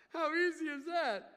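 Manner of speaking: laughing it out, high and loud. Real, not acted. Preacher laughing to congregation about Sarah's faith, laughing. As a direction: chuckle through the question.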